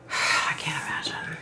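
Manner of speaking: sigh, then whisper it; whispered I can't imagine after a sigh. Real, not acted. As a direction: breathe it out in a whisper. Repeats phrase, fading to whisper.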